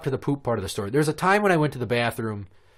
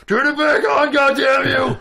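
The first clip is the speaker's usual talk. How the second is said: high, very loud far-carrying holler; Brett the janitor; 14 semitones higher, 8 dB louder than usual. Retold or imitated. Janitor heard through doors and hallways, mock-furious shouting. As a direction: bellow like Sloth through two doors. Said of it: "You can hear him".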